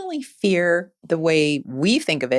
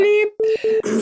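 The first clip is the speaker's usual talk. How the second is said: bleep censor; 13 semitones higher, 3 dB louder than usual. Pretend. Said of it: "If you hear a bleep".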